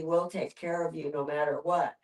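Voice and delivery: ordinary delivery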